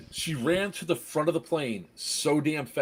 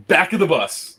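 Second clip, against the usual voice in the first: taunting call; 3 semitones higher, 7 dB louder than usual. Retold or imitated. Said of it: "So it's like".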